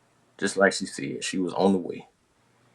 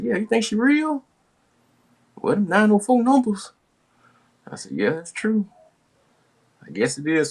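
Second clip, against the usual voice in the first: retold dialogue. Dialogue with brother.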